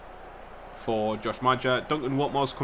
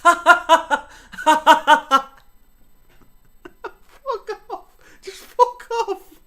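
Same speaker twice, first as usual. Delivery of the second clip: much higher, cackling; laughing rejection; 11 dB louder, much slower than usual. Real, not acted. Rejecting Chelsea's bid, laughing. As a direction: laugh it off and swear.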